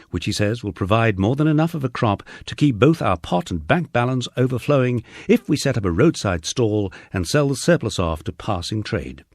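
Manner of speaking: plain reading voice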